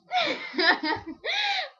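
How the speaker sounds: high squealing laugh burst; loud laughter. Real, not acted.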